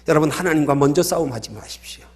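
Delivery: usual speaking voice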